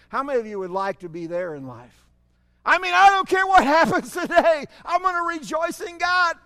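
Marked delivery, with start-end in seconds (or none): 2.6-6.4 loud, high burst with a laugh; joyful declaration. Real, not acted. Preacher declaring joy in God, loud and laughing. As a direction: shout your joy no matter what. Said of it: "defiant joy".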